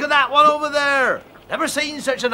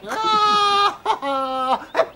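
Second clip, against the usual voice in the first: mocking laugh; 8 semitones higher than usual. Rooster mocks duckling.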